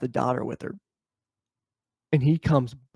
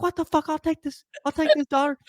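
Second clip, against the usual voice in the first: higher, hammed-up snarl at her; mock him cursing her out; angry neighbor.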